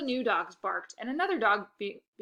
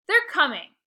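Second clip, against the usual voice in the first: raised, high and insistent; defensive kid, louder and higher; 7 semitones higher, 10 dB louder than usual. Acted. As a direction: insist it loudly. Narrator insisting to sister.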